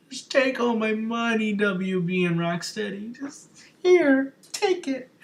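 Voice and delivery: very high, strained fanboy squeal; overexcited fan. Retold or imitated. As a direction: squeal it, stretching the last words out. Acts out reaction to the trailer.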